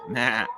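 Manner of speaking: "Matt" bleated like a sheep with no T. Shown as a demonstration. Explaining the name to friends, bleated "Matt". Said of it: "like a sheep".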